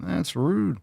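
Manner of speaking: dog's voice, offended; soft mock offense. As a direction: play the dog, wounded. Scolding the dog.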